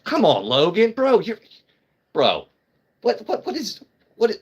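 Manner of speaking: high-pitched, stammering mock disbelief. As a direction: heckle in a squeaky, incredulous voice. Acting out scene with struggling Wolverine.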